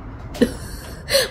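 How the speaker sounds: high airy giggle; breathless squeaky giggle. Real, not acted. Vlogger laughing at a lopsided toy, airy giggle.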